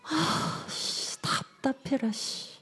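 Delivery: hushed, exasperated sigh of words; frustrated muttering. Acted. Voicing a child's hidden feelings, frustrated mutter. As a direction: mutter under the breath in acted frustration. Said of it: "그 아이들의 진짜 감정이 뭘까".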